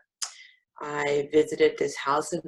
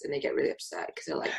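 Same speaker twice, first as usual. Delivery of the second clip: usual speaking voice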